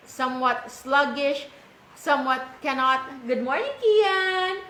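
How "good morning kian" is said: high, slowed sing-song greeting. Real, not acted. Greets the small child, breaking off lecture.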